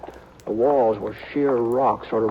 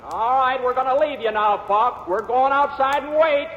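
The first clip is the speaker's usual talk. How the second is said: high, raised call carried into the dark; high challenging shout into a cave; 13 semitones higher than usual. Acted. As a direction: call out to the hidden outlaw across the cave. Calling out to outlaw in cave.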